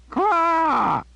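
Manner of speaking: high, loud raven caw; piercing caw. A narrator's reading. Raven cawing at Jillian in frustration, piercing caw. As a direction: shriek it like an angry raven. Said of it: "a piercing caw".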